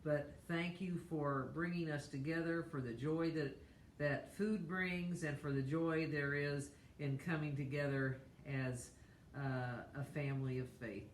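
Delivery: soft, hushed mealtime prayer. Acted. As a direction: say grace quietly and earnestly.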